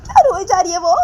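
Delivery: very high, loud outburst; acted squealing complaint at full pitch; shrieked exasperation. A narrator's reading. Appealing to mother about Nisha's crying, exasperated shriek.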